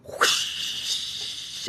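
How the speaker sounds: breathy whoosh sent to the viewer; whooshing sound. Pretend. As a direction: blow the vibes over to her. Sending labor vibes to viewer.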